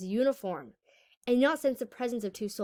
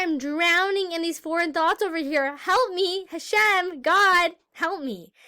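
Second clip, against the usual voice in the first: higher-pitched cry out to God; acted plea for help; 6 semitones higher, 4 dB louder, slower than usual. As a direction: call to God as if drowning in thoughts. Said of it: "You can say".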